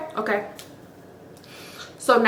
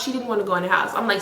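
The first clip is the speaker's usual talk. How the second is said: normal talking voice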